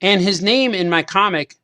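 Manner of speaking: ordinary talk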